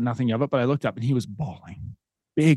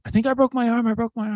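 put-on high whiny kid voice, much higher; imitating crying son; 11 semitones higher, faster than usual. Dad imitating his son at the bench. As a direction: whimper it like a hurt child.

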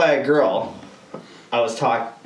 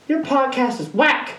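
much higher, slow mocking girl voice; higher, slow imitation of her text; mock girl insult; 11 semitones higher, slower than usual. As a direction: drag out her put-down in a high voice. Girl's insult acted out.

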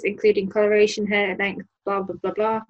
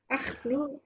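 soft, high Scottish groan; a small, half-hearted Scottish cry; attempted Scottish accent; 4 semitones higher, 10 dB quieter than usual. Player showing what the dwarven lady says, softer Scottish wail. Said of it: "I don't know the difference between the Scottish accents".

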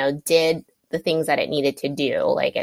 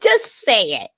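laughing impatience; 11 semitones higher, 3 dB louder than usual. Real, not acted. Wants the news right now.

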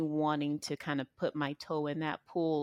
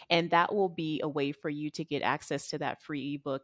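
ordinary delivery

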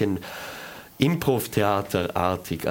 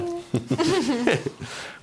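high laugh. Real, not acted. Laugh after jingle.

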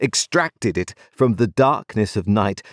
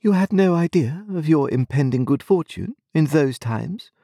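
higher, coolly questioning; haughty young lady; 9 semitones higher than usual. A narrator's reading. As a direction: ask with idle superiority. Estella voiced in reading.

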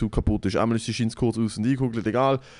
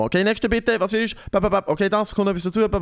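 a hint of a hurried cashier rattling off orders; rushed cashier; 7 semitones higher than usual. Cashier hurrying the queue, mildly mimicked rushed voice. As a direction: rattle it off like a busy kebab cashier. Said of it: "so in dem Tempo".

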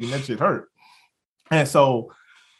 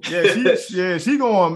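usual speaking voice

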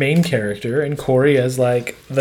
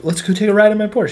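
high, fast, eager pitch; eager invite; 7 semitones higher, faster than usual. Retold or imitated. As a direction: sell the joyride eagerly. Jock boasts in retold scene.

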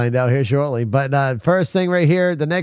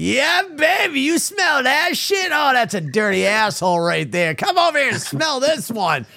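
mock fart-proud braggart; 12 semitones higher than usual. Host to chat, mock-bragging about his fart in high voice. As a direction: croon it like a proud sleazy show-off.

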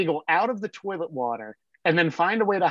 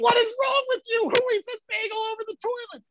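high mock-yelling dad; imitating dad screaming. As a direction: yell at the kid in disbelief. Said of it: "he's screaming bloody murder".

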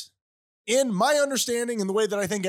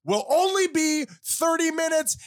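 high, slow proclaiming shout; royal decree; 7 semitones higher, slower than usual. Acted. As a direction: declare it like a king.

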